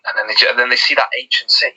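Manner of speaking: ordinary talk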